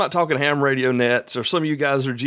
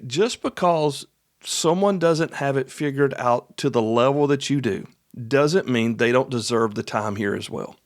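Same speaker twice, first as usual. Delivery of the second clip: normal talking voice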